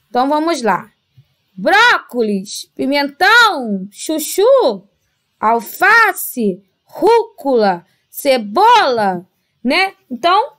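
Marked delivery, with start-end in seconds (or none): 1.6-9.2 Brócolis, pimentão, chuchu, alface, rúcula, cebola, each drawn out on its strong syllable; calling the word. Shown as a demonstration. Teacher calling vegetable names for pupils, each stretched on its stressed syllable. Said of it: "De forma que nós estamos chamando a palavra".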